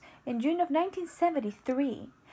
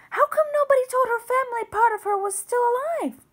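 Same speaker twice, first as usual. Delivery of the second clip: loud, much higher, indignant cry; outraged; 10 semitones higher, 8 dB louder than usual. Acted. Babette protests that the family was never told, loud outraged cry. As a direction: cry out at the injustice.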